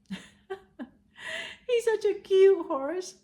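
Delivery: high, slow, laughing coo over a cute horse; laughing fondly. Real, not acted. Fond aside about the horse.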